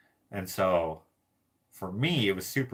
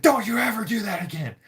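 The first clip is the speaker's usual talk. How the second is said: higher, scolding mom voice; imitated angry mom; 12 semitones higher, 4 dB louder than usual. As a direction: scold like a furious mother. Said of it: "she was so mad".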